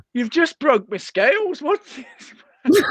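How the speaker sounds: a doctor grumbling over broken scales; indignant doctor. Retold or imitated.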